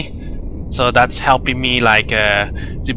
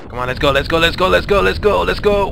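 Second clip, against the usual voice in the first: rising higher and higher, urgent; excited urging; 7 semitones higher, faster than usual. Real, not acted. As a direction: push harder each time, climbing up. Urging character to swim fast.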